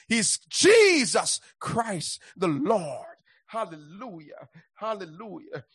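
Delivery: ordinary delivery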